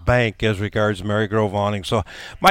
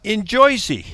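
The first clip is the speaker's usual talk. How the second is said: put-on Jersey accent; 11 semitones higher, 4 dB louder than usual. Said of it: "don't try to do a Jersey accent".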